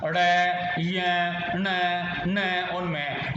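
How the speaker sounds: ड़, य, ण, न said slowly through the nose; nasal letters demo. Shown as a demonstration. Teacher showing the nasal letters slowly. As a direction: let each nasal ring in the nose. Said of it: "वर्गों के पांचवें अक्षर".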